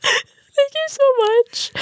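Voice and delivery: very high, slow mock sobbing thanks; tearful gratitude. Driver to cop who spares her a ticket, tearful squeal. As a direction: squeak out thanks through pretend tears.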